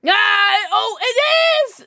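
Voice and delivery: loud high delighted shout; breathy cutesy giggly. Real, not acted. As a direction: squeal it out with delight. Excited about a shirt.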